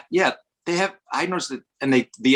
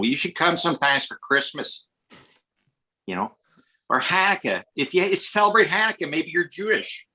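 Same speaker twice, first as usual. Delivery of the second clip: higher, folksy Midwestern hospitality; Midwest accent; 6 semitones higher than usual. Shown as a demonstration. Chicagoan inviting guests for the holidays, imitated Midwest accent. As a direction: play a friendly Chicagoan in a broad Midwest accent. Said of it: "Midwest here is sort of like".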